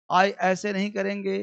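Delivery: ordinary talk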